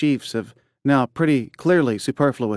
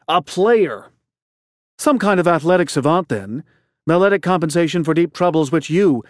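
higher, louder, accusing; heated retort; 5 semitones higher, 4 dB louder than usual. Acted. Man accusing the headmaster of muzzling the boy, heated. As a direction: throw the blame back at him.

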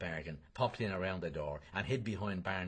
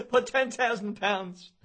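laughing; 10 semitones higher, 6 dB louder, slower than usual. Acted. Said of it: "and he doubled up".

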